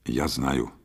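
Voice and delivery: low, muted gloomy reply; understated sullenness. A narrator's reading. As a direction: answer glumly and quietly. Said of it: "сказал он угрюмо".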